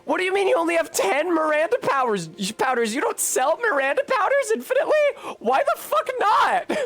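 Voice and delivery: building from indignant to a shrieking high fake rant; mock outrage. Ranting at game vendor's stock.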